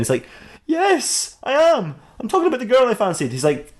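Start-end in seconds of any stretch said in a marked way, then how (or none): 0.7-3.3 high-pitched, petulant yes; hammed-up touchy reply; defiant admission. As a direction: own up to it with theatrical defiance. Batman snaps back about his crush.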